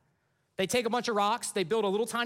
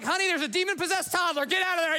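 high, urgent comic warning; mock alarm; 8 semitones higher, 4 dB louder than usual. Preacher warning honey about a scary toddler, high mock alarm. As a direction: call it out in hammed-up panic.

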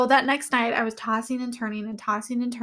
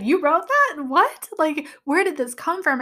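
higher, louder incredulous questions; astonished disbelief; 5 semitones higher, 4 dB louder than usual. Retold or imitated. Retelling husband's reaction to her story, astonished disbelief. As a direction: imitate someone amazed, pitched up and loud.

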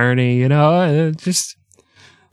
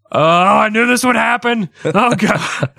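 panicked cry; 9 semitones higher than usual. Acted. Doomed man's last words, comic.

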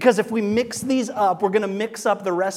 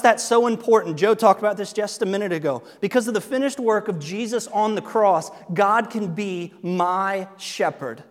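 ordinary talk

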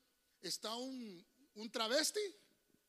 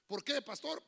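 usual speaking voice

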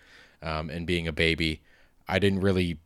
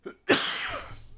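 real cough. Real, not acted. Sick speaker coughing before talking.

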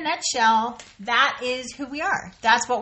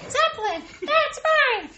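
very high-pitched comic claim; mock possessive squeal. Comic escalating repeated claim.

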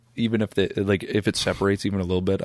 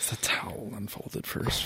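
soft, slow whisper; 10 dB quieter than usual. Real, not acted. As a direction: breathe it under your breath. Repeating the joke under breath.

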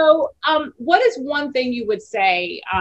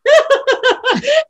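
high, loud laughing sob; helpless laughing with tears; high laugh-cry; 8 dB louder than usual. Real, not acted. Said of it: "laugh crying".

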